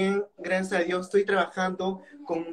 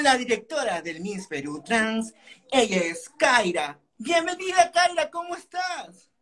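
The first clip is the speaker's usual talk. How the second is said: radio host voice; 2 semitones higher than usual. Shown as a demonstration. Guest demonstrating his Radio Satipo on-air introduction. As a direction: welcome listeners like a DJ. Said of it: "yo diría".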